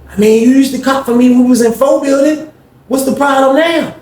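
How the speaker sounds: a cellmate asking why T Weezy stopped buying; Greg's high pleading voice. Retold or imitated. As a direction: whine it up high like you're hurt. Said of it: "He said".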